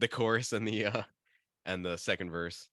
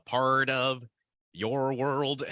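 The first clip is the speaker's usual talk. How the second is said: nasal, higher [name]-style singing; [name] accent; 7 semitones higher, 7 dB louder, much slower than usual. Shown as a demonstration. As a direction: sing it way more nasally like [name]. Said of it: "make it way more nasally".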